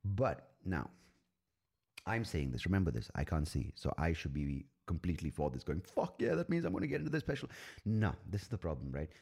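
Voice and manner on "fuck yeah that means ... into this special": high-pitched burst of acted excitement; hammed-up thrilled cheer; mock elated. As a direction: celebrate in a quick, squealing rush. Acts out thrilled reaction to inclusion.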